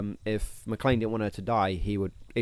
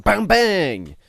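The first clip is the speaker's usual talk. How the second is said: loud, higher shouted bang; mock gunshot bang; 9 semitones higher, 7 dB louder than usual. Describing shooting McClane, a loud mock bang. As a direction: punch out the bang like a shot.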